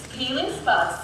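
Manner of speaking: ordinary delivery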